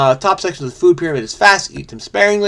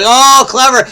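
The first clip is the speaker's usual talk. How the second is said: high, loud burst of delight at a clever clue; genuinely impressed exclamation; 9 semitones higher, 7 dB louder than usual. Real, not acted. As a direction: admire the trick out loud.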